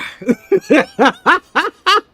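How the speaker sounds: very high, loud cackling laughter; loud cackle. Real, not acted. Streamer after mimicking gamers, high loud cackle. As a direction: cackle hard at your own joke. Laughs at own Southern impression.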